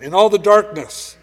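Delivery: in all the darkness thrown out loud and high; loud proclamation. Shown as a demonstration. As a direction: proclaim it to the back row. Repeated phrase, loud then hushed.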